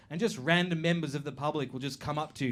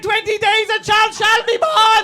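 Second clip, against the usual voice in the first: mock prophet; 10 dB louder than usual. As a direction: intone like a possessed seer.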